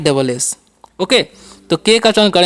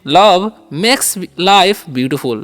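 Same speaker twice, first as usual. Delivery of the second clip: "Love makes life beautiful" drawn out word by word; very slow model; much slower than usual. Shown as a demonstration. Teacher modelling an English sentence very slowly. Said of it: "ऐसे भी बोल सकते हैं".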